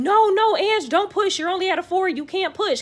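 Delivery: high, loud imitation of the room yelling; urgent mimicked yelling, dropping off at the end; retold group yell. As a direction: shout the warning like a frantic crowd. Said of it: "everybody in the room yelling".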